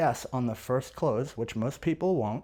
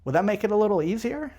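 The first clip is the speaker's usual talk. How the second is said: higher, quick question rising at the end; reasonable man tonality; 7 semitones higher, faster than usual. Shown as a demonstration. Salesperson's third ask for the sale, reasonable and light. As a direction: ask it as an easy, reasonable favor. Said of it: "it's still reasonable man tonality".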